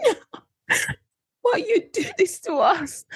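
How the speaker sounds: high-pitched hammed-up crying plea; mock tearful wail. Traveller retelling the visa shock to listeners, mock tearful wail. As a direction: wail it like playful waterworks. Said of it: "Waterworks happened. I'm like".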